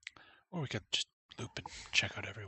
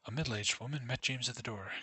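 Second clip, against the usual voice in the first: ordinary talk, nothing marked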